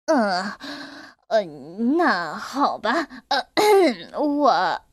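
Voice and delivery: hesitant stammer with throat clearing; a cornered wolf stalling. Acted. Wolf stammering before mother sheep, halting. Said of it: "老狼变得结巴起来".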